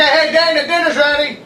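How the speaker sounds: calling out across the house. Real, not acted. Calling Dana to dinner.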